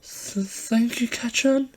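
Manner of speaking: high, slow, stammering small voice; shaky thanks. Acted. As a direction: stammer it out, dazed and tearful. Izuku dazed after being punched.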